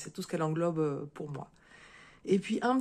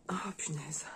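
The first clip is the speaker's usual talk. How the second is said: under-the-breath whisper; whispered little curse while struggling with the box; 6 dB quieter than usual. Real, not acted. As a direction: mutter it to yourself, barely voiced. Fumbling as she opens the box.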